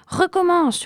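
crié très fort et très haut, en colère; hurlement. A narrator's reading. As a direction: hurle l'ordre au jeune faucon. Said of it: "hurle Paul". (cri furieux du fauconnier)

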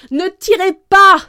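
very loud, high command; urgent shout. A narrator's reading. Athos stopping friends from shooting, urgent loud shout. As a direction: shout to halt the gunfire. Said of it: "cria Athos".

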